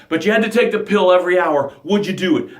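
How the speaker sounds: ordinary talk, nothing marked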